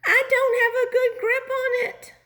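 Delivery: very high, loud, crying complaint; high whiny voice. Acted. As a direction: wail about losing your grip. Struggling to hold the roof.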